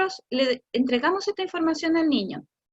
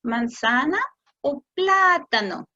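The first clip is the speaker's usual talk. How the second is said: slow modeling voice; slower than usual. Shown as a demonstration. Parent offering fruit to child, slow and clear. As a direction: ask the child slowly, stretching plátano. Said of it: "tú le quieres decir".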